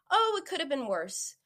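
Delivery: high, sing-song mock cheer; glib consolation. Someone answering another's emotional pain, glib consolation. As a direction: chirp empty comfort from a high pitch. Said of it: "You're actually invalidating them".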